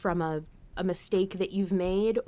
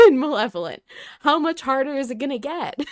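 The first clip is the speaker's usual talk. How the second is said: laughing through tears, higher; tearful half-laugh; teary laugh; 6 semitones higher, 10 dB louder, faster than usual. Real, not acted. Crying, laughing at own tears.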